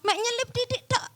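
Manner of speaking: high-pitched, softened little aside; playful belittling. Acted. Preacher showing the congregation how small the hurt is, high and dismissive.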